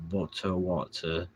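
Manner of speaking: "Boto Wata" drawn out with British vowels; understated mock British accent; mock British pronunciation. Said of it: "how to pronounce British words".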